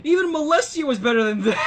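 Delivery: high shouted jeer through laughter; a crowd heckler laughing as he shouts; laughing heckle. Acted. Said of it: "and at least one".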